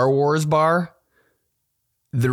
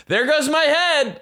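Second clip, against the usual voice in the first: mock panic.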